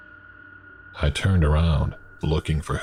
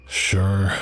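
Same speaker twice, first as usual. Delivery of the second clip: yawning; 5 semitones higher than usual. Acted.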